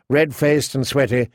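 ordinary delivery

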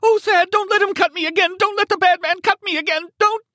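piercingly high, loud, frantic begging; terrified shrieking. Acted. Wounded captive begs man on phone, shrieking. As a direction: plead at the top of your voice. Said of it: "she shrieked".

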